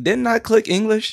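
high, baffled caller voice; confused caller. Retold or imitated. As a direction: squeak it out like a confused customer. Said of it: "It'd be like".

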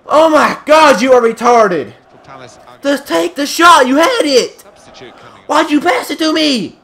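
frustrated yelling. Real, not acted. Raging at teammate in game.

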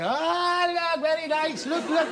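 high, delighted tourist voices pointing; imitating excited tourists. Actor mimics tourists spotting him naked, high and delighted. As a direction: squeal like gawking sightseers. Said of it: "they're all going".

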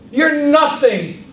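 You're hit high and slow, then nothing; stress on You're. Shown as a demonstration. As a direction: lean hard on You're, drawn out. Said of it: "Do you hear what I did".

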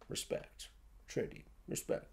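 breathy hushed whisper of awe; whispered reverence. Retold or imitated. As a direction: whisper it like sacred praise. Neo's reverent line mocked.

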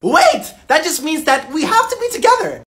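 squeaky, pleading high voice; cling to the hope, pleading; high desperate plea. Acted.

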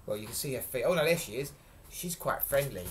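plain reading voice

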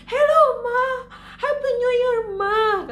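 put-on high, loud tearful child voice; crying child. Twin wishing mom happy new year, high teary child voice. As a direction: say it like a kid half crying with joy.

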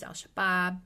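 ordinary talk

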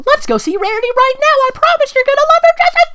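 high, loud cartoon princess, eager to help; squeaky Twilight voice. Reader voicing Twilight suggesting a name, high and squeaky.

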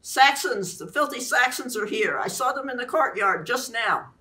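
high, frantic battle cry; raging warrior. A narrator's reading. Traumatised Griffith bursting into the hall, frantic high rage. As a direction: rave like a shell-shocked soldier. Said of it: "contorted with rage".

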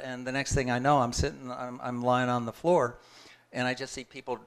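usual speaking voice

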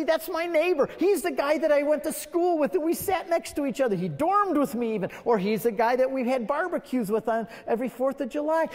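desperate to know what happened to a friend; urgent pleading. Acted. Pleading with angel at judgment.

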